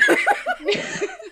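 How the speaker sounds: sudden high squealing laugh. Real, not acted. High laugh to her friend about her cheeky plan. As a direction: let out a high-pitched giggle.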